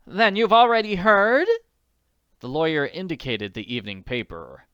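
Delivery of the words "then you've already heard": much higher, louder fretful lady; worried wealthy woman; anxious society lady. Acted. Willoughby voice.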